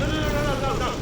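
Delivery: sarcastic high no no no. Acted. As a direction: refuse with dripping sarcasm. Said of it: "Pick up on my sarcasm".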